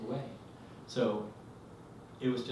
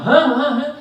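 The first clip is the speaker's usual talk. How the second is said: loud, high startled huhs; jolted from sleep and blurting in alarm; startled awake; 16 dB louder than usual. Retold or imitated. Husband woken by his wife's screams, startled.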